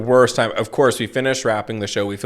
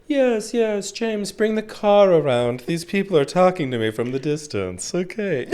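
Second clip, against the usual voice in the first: rich person voice; 8 semitones higher than usual. Retold or imitated. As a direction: order the butler around like a hammed-up aristocrat. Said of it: "rich person's like".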